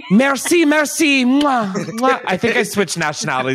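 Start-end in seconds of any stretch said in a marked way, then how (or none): 0.1-2.2 high, loud mock French thanks with air kisses; French accent. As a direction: blow kisses like a hammed-up French star. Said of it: "Turn into French".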